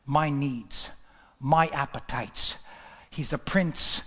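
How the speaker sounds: low and measured, gradually firming up; grave, subdued preaching. Real, not acted. Preacher lamenting Esau's low choice, low and grave. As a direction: bring it right down, then press the point.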